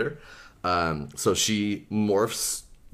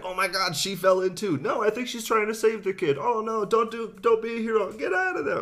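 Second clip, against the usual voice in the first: much higher voice for the panicked onlookers; bystanders in panic. Retold or imitated. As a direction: squeal like a frightened crowd. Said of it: "hears other people be like".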